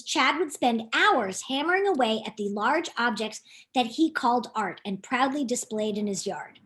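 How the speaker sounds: plain reading voice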